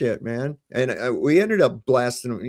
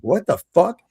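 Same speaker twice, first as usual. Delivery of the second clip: sudden higher-pitched disbelief; retold startled outburst; 8 semitones higher, 3 dB louder than usual. Seeing lights outside while at home, retold startled outburst. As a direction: blurt it out, higher.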